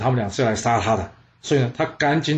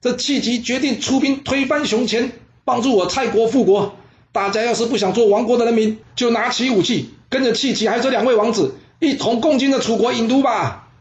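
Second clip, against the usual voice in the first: high, loud rallying cry; loud, high call to arms; shouting in the street; 10 semitones higher, 3 dB louder than usual. Acted. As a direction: rouse the crowd from the street. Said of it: "跑出去到这街上大声嚷嚷".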